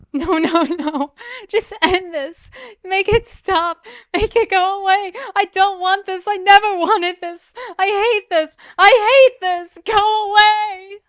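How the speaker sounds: high, loud, slowing anguished shriek; anguished screaming. A narrator's reading. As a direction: scream out the pain. Zin breaks down, screaming.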